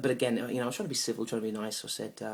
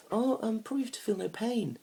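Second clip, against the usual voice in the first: high-pitched imitation of the date; date's reply; 13 semitones higher, 4 dB louder than usual. Date answering a superpower question, voiced high.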